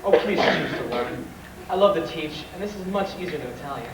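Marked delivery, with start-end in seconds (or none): 0.0-1.3 tender pleading. Acted.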